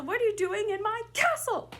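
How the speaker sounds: high, loud shout landing on "castle"; stress on castle. Shown as a demonstration. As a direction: save the biggest punch for "castle". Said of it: "pick one word in that sentence to really emphasize".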